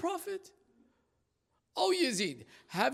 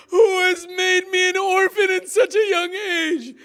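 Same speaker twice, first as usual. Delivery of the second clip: high, loud sobbing cry; weeping child's lament; 10 semitones higher, 11 dB louder than usual. A narrator's reading. Child weeping over her father's head, sobbing cry. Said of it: "The child touched her lips".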